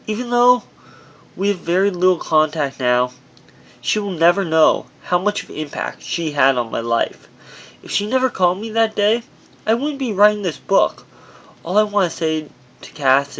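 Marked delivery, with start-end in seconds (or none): none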